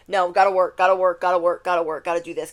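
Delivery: loud, insistent repeated excuses; busy refusal. Retold or imitated. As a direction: rattle off excuses like a mock workaholic. Mimics a busy person refusing invitations.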